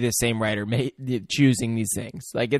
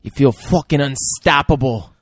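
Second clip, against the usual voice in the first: loud, punched-up echo of a pep talk; emphatic hype; 6 dB louder than usual. Retold or imitated. Echoing the coach's pep talk, emphatic hype. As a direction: belt it out with full conviction. Cohost amps up the quoted line.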